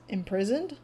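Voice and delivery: mildly puzzled questioning rise; confused. Retold or imitated. Telemachus caught by the term, mildly confused. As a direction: echo the word as a baffled question. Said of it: "like, confused".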